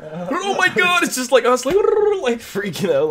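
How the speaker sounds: high, slow, laughing shriek; excited laughing. Real, not acted. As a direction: squeal with delight at the play. Caster laughs and shrieks at the fight.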